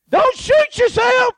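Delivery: high, slow called-out warning; imagined warning from Jesus. Retold or imitated. Jesus imagined warning the preacher at the range, high and slow. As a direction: call it out slowly and high, half joking.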